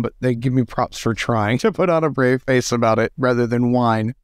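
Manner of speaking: ordinary talk, nothing marked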